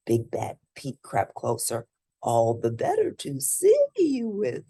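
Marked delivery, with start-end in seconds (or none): 2.2-4.7 high funny voice. Acted. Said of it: "he said".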